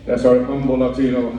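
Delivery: ordinary talk, nothing marked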